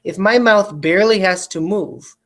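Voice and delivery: ordinary delivery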